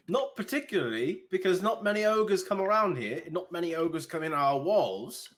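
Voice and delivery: put-on an officious young guard scolding; indignant guard. Guard confronting the party, high and indignant.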